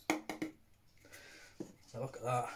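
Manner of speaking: hushed, slow, breathy look-at-that; drawn-out hushed admiration; awed whisper. Real, not acted. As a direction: whisper it in awe of the pour. Admiring the beer he poured.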